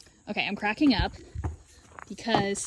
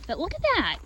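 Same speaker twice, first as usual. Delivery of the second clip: high playful tut-tut; mock scolding; 9 semitones higher than usual. Real, not acted. Scolding the pushy sheep, high and playful. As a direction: tease the pushy ewe.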